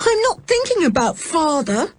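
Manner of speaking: high, agitated protest; distressed cry. A narrator's reading. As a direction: protest in a high, upset child's voice. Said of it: "cried George".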